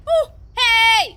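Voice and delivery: loud, high bark for attention; shout. Acted. Streamer shouting down laughing characters. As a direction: yell over the room.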